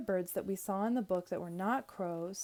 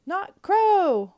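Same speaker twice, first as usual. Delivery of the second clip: high, loud gleeful yell; drawn-out high holler; 11 semitones higher, 14 dB louder than usual. A narrator's reading. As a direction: shout it out across a meadow. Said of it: "I yelled".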